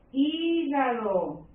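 "Hígado" drawn out slowly as "hí-ga-do"; syllable by syllable. Shown as a demonstration. Teacher showing the division, syllable by syllable. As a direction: stretch "Hígado" into three slow beats. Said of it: "aquí ya la división".

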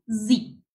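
Z as "zee" with a buzzed, voiced start; buzzing Z. Shown as a demonstration. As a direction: buzz the start of Z like a bee. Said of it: "tienen que hacer como un zumbido". (Z con zumbido al inicio, "zzi")

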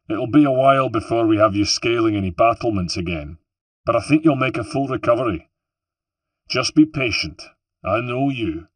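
a gruff boss teasing a patient; hearty reassurance. Acted. Antonio reassuring Rosa.

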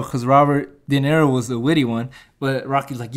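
normal talking voice

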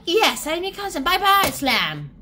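loud, high mock-cheery dismissal; brusque exit. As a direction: announce it loudly, then storm off. Acted brusque exit of Mary.

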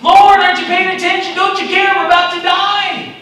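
very high, loud terrified shout; panicked cry. Acted. Disciples in the storm shouting at sleeping Jesus in panic. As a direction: scream over the storm for help. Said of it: "that storm arose".

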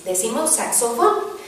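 usual speaking voice

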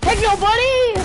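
high, loud teasing call; loud taunt. Real, not acted. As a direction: belt the taunt out gleefully. Taunt said two ways.

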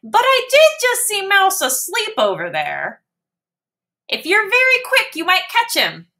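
high squeaky mouse, quick. Acted. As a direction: hurry him off with a squeak.

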